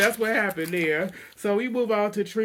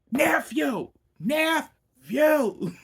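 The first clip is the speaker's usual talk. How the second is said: higher, loud shout across the room; calling out; 10 semitones higher, 5 dB louder, much slower than usual. Retold or imitated. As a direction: yell for the nephew to come over. Calling out to nephew at party.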